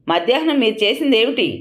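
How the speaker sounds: a mild narrated roar. A narrator's reading. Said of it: "గర్జించింది".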